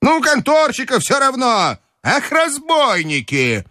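put-on high, indignant old lady; high-pitched scandalised matriarch; outraged grandmother. A narrator's reading. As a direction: cry out high, dragging the final insult.

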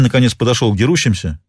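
usual speaking voice

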